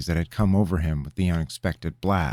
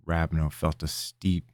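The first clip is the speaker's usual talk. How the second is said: ordinary delivery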